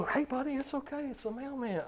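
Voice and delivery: put-on what the dad would not say, soft and babying; coddling voice. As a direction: coo at him like a baby. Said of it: "I don't go".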